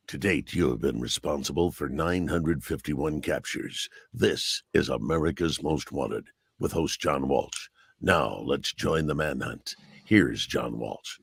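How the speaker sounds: low, measured TV announcer read; show announcer. Shown as a demonstration. Voiceover artist introducing America's Most Wanted, low announcer read. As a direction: drop low and intone the crime show intro. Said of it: "I did the, you know, uh, I came on and I".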